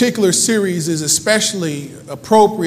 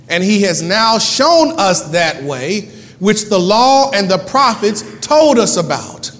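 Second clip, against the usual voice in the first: slow, punched Bible reading; deliberate pulpit proclamation; emphatic scripture reading; 4 dB louder than usual. Acted. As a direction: read in weighty phrases with pauses. Reads scripture with preaching force.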